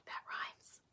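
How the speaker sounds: breathless secret whisper; whispered aside. Acted. Teacher whispering to the kids about the rhyme, hushed.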